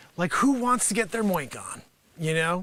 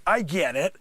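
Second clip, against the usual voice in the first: ordinary delivery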